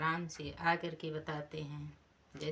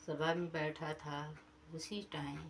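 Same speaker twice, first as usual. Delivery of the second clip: ordinary delivery, nothing marked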